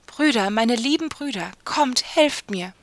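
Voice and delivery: desperate cry. A narrator's reading. Sister calling brothers in greatest need, desperate cry. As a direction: call out with all urgency. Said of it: "schrie sie zum dritten Mal in der höchsten Not".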